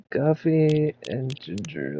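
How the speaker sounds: drawn-out repeat of the order; slow musing read. Acted. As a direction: savor each word slowly. Reading order back slowly.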